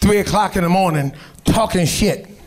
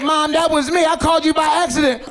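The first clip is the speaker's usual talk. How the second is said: high-pitched sheepish plea; mock confession; 7 semitones higher than usual. Grandson admitting the call to grandma, high sheepish plea. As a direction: squeak it out guiltily.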